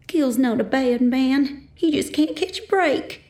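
tearful pleading. A narrator's reading. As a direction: plead for him through tears. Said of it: "my mom's tearful voice".

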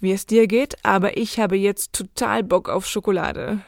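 ordinary delivery

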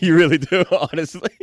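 laughing loudly while talking. Real, not acted. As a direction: crack up mid-sentence. Laughing at the story idea.

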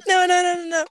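loud, high rapid noes; high panicked protest. Real, not acted. Friend begging Ava not to quit, loud and high. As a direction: beg her not to leave.

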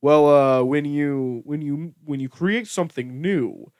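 slow, put-on deep voice; Elizabeth Holmes. Founder answering interviewer about doubters, mock deep voice. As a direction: imitate her deep, deliberate interview answer. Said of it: "this is what Elizabeth Holmes says".

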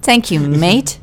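clumsy mock-British catchphrase; bad British accent. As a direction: have another poor go at British. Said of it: "British accent".

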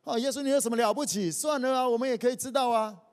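high, loud, fast scoffing; preacher mocking the proud in a loud, fast sneer; proud scoffers. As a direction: sneer like an arrogant Pharisee. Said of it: "那些骄傲的人讲说".

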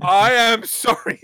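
high, loud, hammed-up sorry; mock dramatic apology. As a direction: overplay the apology, voice way up. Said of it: "And it's like".

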